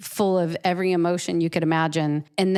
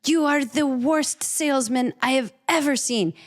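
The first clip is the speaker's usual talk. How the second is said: put-on high, strong foreign-accented exasperated voice; exasperated neighbor who can't watch anymore; gallery owner Chaim; 9 semitones higher than usual. Gallery owner fed up with the artist's selling, raised exasperated voice. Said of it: "and he goes".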